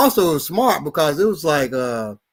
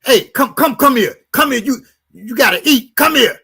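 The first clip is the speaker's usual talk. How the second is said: put-on raised, slurring drunk man calling the girl; mock a drunk man calling her over; drunk boyfriend; 7 semitones higher, 3 dB louder than usual. Drunk boyfriend calling the girl over, hammed up.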